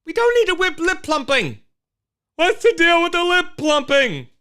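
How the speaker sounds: high, loud incredulous outburst; exasperated rant. Real, not acted. Host ranting to viewers about lip plumping, high and loud. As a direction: throw it up high and loud in disbelief.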